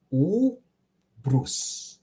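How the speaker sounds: "Ubrus" drawn out, syllables pulled apart; long first U. Shown as a demonstration. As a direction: stretch the opening U of "Ubrus", then pause before brus. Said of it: "the first one was, uh, longer".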